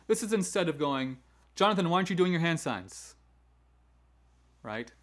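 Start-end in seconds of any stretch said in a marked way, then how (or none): 1.6-3.2 quick, raised-pitch reproach; negative scolding. Shown as a demonstration. Teacher asking Jonathan about hand signs, scolding. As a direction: demonstrate a nagging correction, fast and higher. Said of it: "opposed to being always negative".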